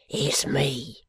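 hushed, breathy whisper. A narrator's reading. Old man to the lawyer in a dark room, whispered. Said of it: "Thus whispering, they both go in".

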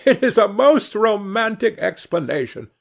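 high-pitched amused hostess; a touch of mirth in a high voice; amused, laughing tone. A narrator's reading. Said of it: "laughed the hostess".

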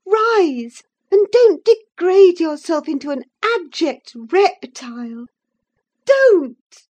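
high, loud furious rebuke; passionate scolding. A narrator's reading. Catherine scolding Linton in a passion. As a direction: tear into him with scorn. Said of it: "in a passion".

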